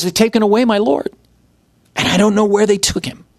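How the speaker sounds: Mary crying to the angels; Mary's anguish. Retold or imitated. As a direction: blurt it out in grief. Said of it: "And she says".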